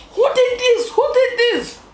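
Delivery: very high, shrill-pitched angry demand; screaming mom. Retold or imitated. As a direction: imitate mom shrieking an accusation, pitch sky-high. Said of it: "My mom started screaming".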